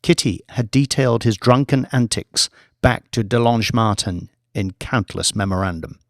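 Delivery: usual speaking voice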